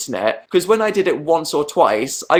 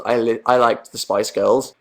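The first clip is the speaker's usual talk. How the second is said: ordinary delivery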